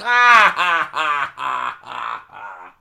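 loud high cackle fading out; evil villain laugh. Retold or imitated. Prankster gloating, high loud cackle. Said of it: "He's like".

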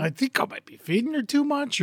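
usual speaking voice

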